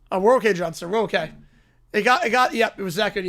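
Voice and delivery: ordinary delivery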